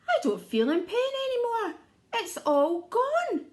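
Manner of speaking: delighted the pain is gone; relieved crocodile. Acted. Crocodile telling the creatures his pain is gone, high and relieved.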